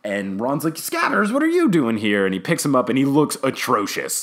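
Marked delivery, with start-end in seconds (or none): 0.8-2.2 high, imitated surprise; surprised discovery. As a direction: exclaim it high at a lost pet. Retelling Ron finding his lost rat.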